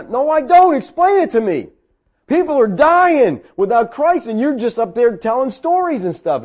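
high, loud, urgent argument; impassioned rebuke. Retold or imitated. Retold confrontation with the preacher.

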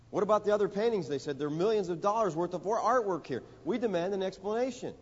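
upset collector protesting to the auctioneer; indignant demand. A narrator's reading. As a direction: demand it as an outraged bidder. Angry bidders demand explanation.

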